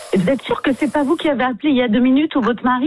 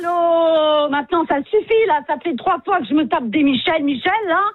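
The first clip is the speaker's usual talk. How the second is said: fed-up, higher-pitched protest; real exasperation; 4 semitones higher than usual. Real, not acted. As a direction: lose patience with a repeat caller. Standardiste excédée par les appels répétés. (exaspérée, à bout de patience)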